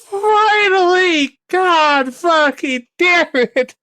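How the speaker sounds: high, slow, drawn-out cry of relief; relieved cry. Real, not acted. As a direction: stretch it out like you finally broke free. Relief after long struggle.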